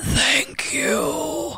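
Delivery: strangled, voiceless croak, drawn out slowly; creepy, rasping, strained whisper; Red from Us. Shown as a demonstration. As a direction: croak it out as if the throat is crushed. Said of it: "Red's voice with an accent".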